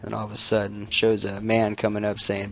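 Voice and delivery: ordinary talk, nothing marked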